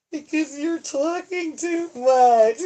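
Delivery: high, slow put-on nagging voice; mock scolding voice. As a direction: scold yourself in a silly high voice.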